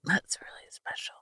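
barely breathed thanks, choked up; tearful whisper. Real, not acted. Whispering thanks to a friend after her tribute. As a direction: whisper it through tears, overwhelmed.

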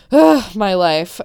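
loud, slow exasperated groan; dramatic sigh. Real, not acted. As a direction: sigh it out, fed up. Exasperated about neighbours' loud music.

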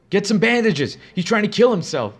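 high, loud urgent shout; roaring. Acted. Nurse shouting to the orderlies to fetch bandages, loud alarmed roar. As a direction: yell for help at the orderlies. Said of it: "she roared at the men".

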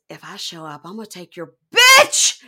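the line as she really yelled it; exact fierce yell. Retold or imitated. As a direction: build to a yelled threat. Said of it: "What she says exactly is".

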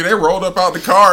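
ordinary delivery

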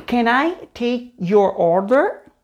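"can I take your order" drawn out word by word; slow teaching take with gaps between words; slow model. Shown as a demonstration. Slow model, then natural take.

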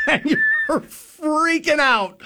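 high, slow words laughed out; laughing. Real, not acted. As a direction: laugh through the panic memory. Laughing while recalling the panic.

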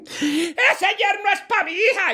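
high, slow laughing mother-in-law; quarrelling mother-in-law. Acted. Mother-in-law claims the son-in-law, high and slow. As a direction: claim him high for your daughter. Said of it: "dos suegras peleando".

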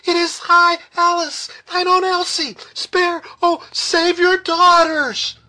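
very high, loud, desperate pleading; shrieked plea, drawn out at the end; piercing cry. A narrator's reading. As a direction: scream for your life to your father. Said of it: "a piercing cry from out the mist".

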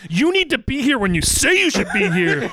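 high-pitched, insistent telling-off; hammed-up nagging over the phone; mock scolding wife. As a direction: imitate a wife laying down the law.

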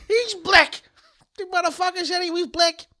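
very high, loud movie sidekick; film character impression. Acting out the film scene for co-host, high and incredulous. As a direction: squeal it in hammed-up disbelief.

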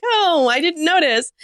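high, drawn-out polite fib; sweetly fake, slow and high; feigned innocence. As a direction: sing it out high, pretending obliviousness. Feigning ignorance of the bathroom noise.

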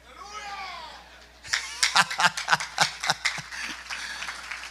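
loud, high cackle of laughter; hearty high laugh. Real, not acted. Laughing at his own joke.

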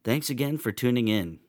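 lively announcer outro over music; upbeat outro. Acted. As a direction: sell the subscribe pitch cheerfully.

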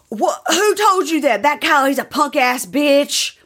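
high, drawn-out mock outrage; hammed-up defensive character voice; imitated riled-up man. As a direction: do his indignant voice, stretching the insult. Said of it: "if you're gonna do the voice".

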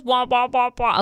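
mock sad trombone noise; sad trombone wah. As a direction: sing the sad trombone fail sound. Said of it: "until you did the wah".

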